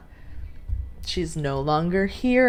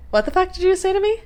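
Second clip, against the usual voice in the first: high, fast outraged question; indignant disbelief; 12 semitones higher, 4 dB louder, faster than usual. Acted. To someone who said your mom died, high indignant disbelief. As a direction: fire it back in high-pitched disbelief. Acted indignant reply to insensitive remark.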